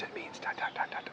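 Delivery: retold secret whisper. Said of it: "and then she whispers in English".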